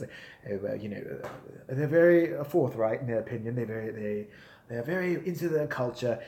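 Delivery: quiet, polite mock British accent with hesitations. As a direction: do a reserved, hedging Brit, softly. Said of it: "they ask Brits or whoever".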